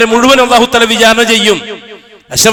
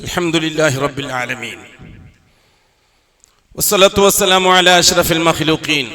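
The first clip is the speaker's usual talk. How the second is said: slow, lower Arabic prayer chant; closing khutbah prayer; 6 semitones lower, 5 dB quieter, much slower than usual. Acted. Preacher closing the sermon, chanting the prayer. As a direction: close the sermon in measured prayer.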